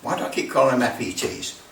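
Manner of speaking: usual speaking voice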